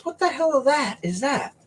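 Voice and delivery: high-pitched retold disbelief; alarmed buddy. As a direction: imitate a startled friend spotting something, without raising the volume. Retelling buddy's alarmed reaction.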